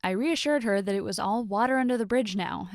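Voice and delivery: usual speaking voice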